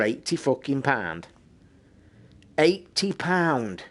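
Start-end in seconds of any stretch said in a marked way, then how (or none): 2.6-3.8 slow disbelief. Real, not acted. Outraged at dock price.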